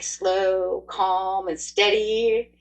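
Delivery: drawn-out, unhurried words; slow, calm, steady. Shown as a demonstration. Reader coaching Capricorn how to act, slow and steady. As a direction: stretch each word out, especially the last. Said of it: "so you're gonna be, like".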